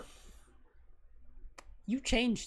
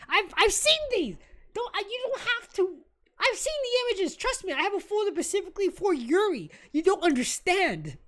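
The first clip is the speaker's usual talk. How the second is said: worked up; 6 semitones higher than usual. Real, not acted. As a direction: cut him off, too excited to let him finish. Worked-up fan rant.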